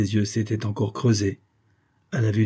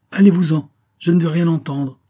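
high, loud order to leave; angry dismissal; 8 semitones higher, 7 dB louder than usual. A narrator's reading. Jacques ordering his old friend out, angrily. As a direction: throw him out, refusing to listen. Acted quarrel, ordering visitor out.

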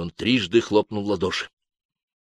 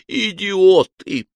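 slow, high, loud insult; furious shout; 12 semitones higher, 7 dB louder, much slower than usual. A narrator's reading. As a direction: spit it at the fools, drawn out. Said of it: "взревел инквизитор".

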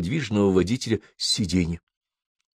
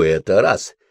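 usual speaking voice

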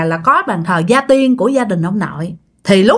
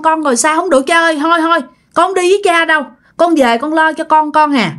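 higher, urgent begging daughter; anxious pleading; 6 semitones higher than usual. Acted. Daughter begs her dead father to let her return to her children, pleading. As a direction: plead with father in a raised pitch, quickening toward the end.

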